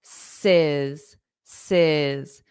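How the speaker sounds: "sis" said alone, falling in pitch; low, separate "sis", each one carefully sounded; syllable demo. Shown as a demonstration. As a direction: isolate the ending "sis" for a learner. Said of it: "The correct pronunciation of spaces".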